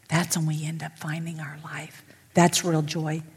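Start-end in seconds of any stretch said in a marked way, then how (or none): 0.1-2.0 hushed, breathy whisper of the key truth; secret-sharing whisper to the congregation. Real, not acted. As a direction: drop to a whisper to draw them in. Preacher hushes the key line.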